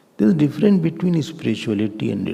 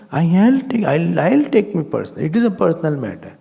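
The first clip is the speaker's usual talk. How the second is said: high, louder, stumbling protest; indignant; 6 semitones higher, 5 dB louder than usual. Acted. Offended man insisting it is personal, indignant. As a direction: act wounded pride, pitched up and insistent.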